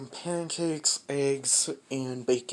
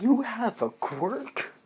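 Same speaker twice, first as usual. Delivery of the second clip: surprised question; 9 semitones higher, 4 dB louder than usual. Retold or imitated. Bakugo stunned to hear Deku has a quirk, high surprised question. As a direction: pitch jumps way up in disbelief.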